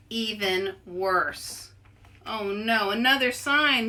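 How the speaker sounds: ordinary delivery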